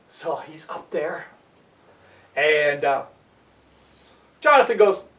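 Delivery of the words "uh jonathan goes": ordinary delivery, nothing marked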